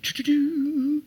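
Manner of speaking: high slow choo-choo train sound; playful mock train whistle chant; train noise. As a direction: chug like a little train. Train noise after ADD moment.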